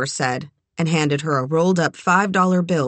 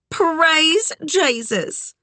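Southern lady voice; 12 semitones higher, much slower than usual. Acted. Sugar's exaggerated exclamation.

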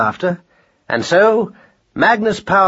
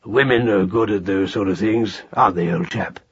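low, confiding chummy voice; man-to-man; 4 semitones lower than usual. A narrator's reading. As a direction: confide in a fellow husband. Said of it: "in a man-to-man voice".

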